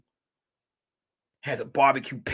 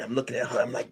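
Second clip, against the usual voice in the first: ordinary talk, nothing marked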